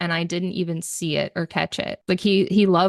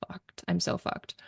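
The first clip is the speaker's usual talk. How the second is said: despairing mutter; 9 dB quieter than usual. Retold or imitated. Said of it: "God's never gonna forgive me".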